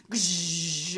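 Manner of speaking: long buzzing lightning noise; spell-blast sound effect; imitated electric zap. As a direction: mouth a drawn-out zap.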